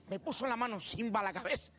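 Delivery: normal talking voice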